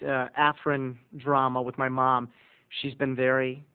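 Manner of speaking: ordinary talk, nothing marked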